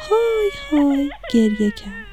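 high, mock-crying voice; put-on sobbing storyteller voice; tearful narration. A narrator's reading. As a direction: narrate it as if wailing. Narrator acts out the broccoli crying.

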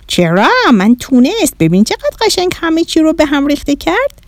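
put-on high-pitched, pleased alien child; little Martian boy. Bahram proud of the mess, little Martian voice.

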